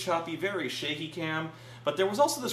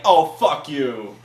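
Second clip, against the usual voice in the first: loud, slow, indignant cry of betrayal; hurt, angry shout, much louder and higher at the end; betrayed outburst; 4 semitones higher, 10 dB louder, slower than usual. Retold or imitated. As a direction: yell it at the screen like a fan who was let down. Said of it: "and I'm like".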